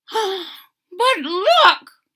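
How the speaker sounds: high, loud call to look; excited old man. Acted. Old man points out kitten to wife, eager and loud. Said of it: "said the very old man".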